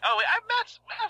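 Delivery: high, loud sudden recollection; sudden realization. Real, not acted. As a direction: catch yourself out loud. Sudden realization mid-sentence.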